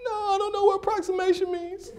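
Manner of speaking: put-on very high, tearful child voice; comic crying-kid impression; whining boy. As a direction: whimper it in confusion. Crying boy answers his coach.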